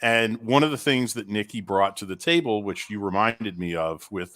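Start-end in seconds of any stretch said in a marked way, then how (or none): none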